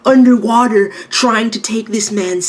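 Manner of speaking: high, indignant mock scolding; a high put-on voice of outrage. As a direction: squeal it like you're scandalized. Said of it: "I'm like".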